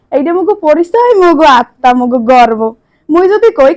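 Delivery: proud, declaiming Barishal dialect; Barishal dialect speech. Acted. Contestant addresses judges, proud Barishal dialect oration. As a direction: orate in broad Barishal dialect, chest out.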